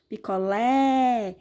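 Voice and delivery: "Picolé" slow, with LÉ wide open and held; stressed open vowel. Shown as a demonstration. Teacher repeats the open sound, stretched LÉ in "Picolé". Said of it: "um som mais aberto".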